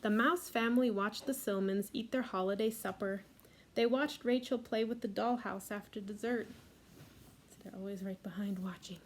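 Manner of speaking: ordinary delivery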